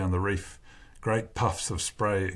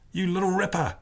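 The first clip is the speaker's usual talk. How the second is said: high-pitched, hammed-up Aussie cheer; mock an Australian, badly and high; awful put-on Aussie accent, pitched way up; 11 semitones higher than usual. A narrator's reading. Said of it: "he mocks in his awful Aussie accent".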